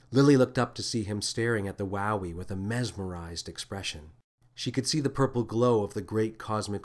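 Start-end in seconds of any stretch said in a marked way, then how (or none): none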